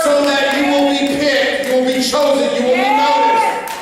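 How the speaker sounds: rising preacher's cadence building to a shout; full-force preaching. Acted. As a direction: drive it home to the congregation.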